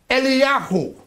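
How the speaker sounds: Eliyahu said the Hebrew way, eh-lee-YAH-hoo; Hebrew name. Shown as a demonstration. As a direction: sound out Eliyahu in Hebrew, high and careful.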